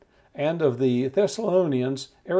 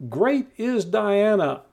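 mild mock crowd chant, higher and slow; drawn-out cry in a raised pitch, half-hearted shout; retold crowd shout; 5 semitones higher, 3 dB louder, slower than usual. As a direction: call it out slowly, imitating a chanting crowd. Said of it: "this large crowd was shouting".

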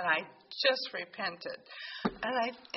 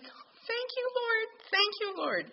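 high, bursting gratitude; joyful thanks; 12 semitones higher than usual. Retold or imitated. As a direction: cry it out in relief and joy. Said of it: "I'm just like".

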